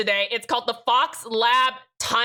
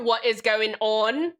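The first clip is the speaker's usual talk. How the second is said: ordinary delivery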